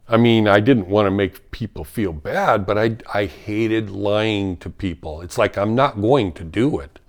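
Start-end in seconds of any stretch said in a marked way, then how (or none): none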